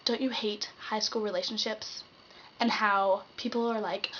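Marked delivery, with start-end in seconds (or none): none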